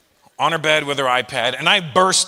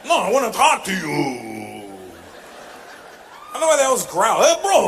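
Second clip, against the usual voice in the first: mock bro shout; 5 semitones higher than usual. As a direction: yell it like an indignant gym bro. Acted Geno quarrel, shouting.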